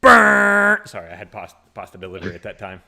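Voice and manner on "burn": very loud, high, sustained buzzer noise; imitated buzzer. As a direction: blast it out like a game horn. Imitated buzzer after segment announcement.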